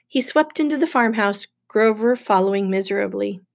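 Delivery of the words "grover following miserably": ordinary delivery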